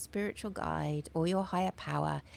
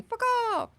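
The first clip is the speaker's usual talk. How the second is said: Fuck off squawked much higher like a crow; 4 dB louder than usual. Retold or imitated. Imitating a crow telling everyone to leave, high squawk. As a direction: caw the words like an angry crow. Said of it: "a crow going".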